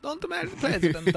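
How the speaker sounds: high, put-on Jackie Kennedy accent. Taking his turn at it, a high mock Jackie Kennedy accent. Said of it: "try and do your best Jackie accent".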